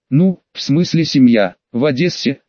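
usual speaking voice

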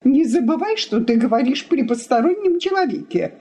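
high-pitched annoyed reprimand; irritated. A narrator's reading. Wife reminds husband of the guest, irritated. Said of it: "раздражённо сказала жена".

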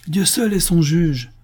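high, slow, indignant exclamation; narrator's measured outburst of temper; angry outcry. A narrator's reading. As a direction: cry it out with righteous vehemence. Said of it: "s'écria madame Brice, avec un emportement".

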